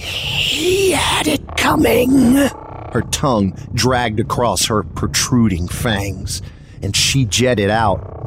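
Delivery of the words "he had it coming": high, slow, hissing creature voice; choppy monster whisper. Acted. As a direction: rasp it slowly like a menacing beast. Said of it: "she said in a choppy whisper".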